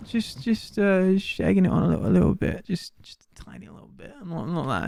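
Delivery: fading sheepish whisper. Real, not acted. Confessing he's egging it on.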